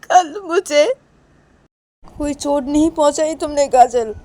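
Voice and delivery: high, wailing hurt reproach; acted tearful protest. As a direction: plead through tears, upset and accusing. Acted Reshma crying, hurt by Kajal.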